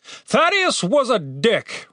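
high, slow retort; drawn-out dismissive answer; dismissive leader. A narrator's reading. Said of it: "replied the leader".

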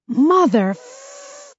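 high, slow, drawn-out pained curse. Acted. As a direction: stretch the F out in pain. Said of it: "he holds out the F".

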